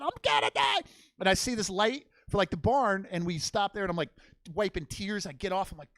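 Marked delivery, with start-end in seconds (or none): 0.0-0.8 much higher, shouted "I'm gonna die"; panicked wail. Shown as a demonstration. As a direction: wail it in terror on the horse. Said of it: "ah, like that".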